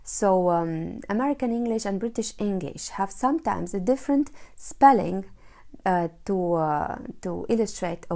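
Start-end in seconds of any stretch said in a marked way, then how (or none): none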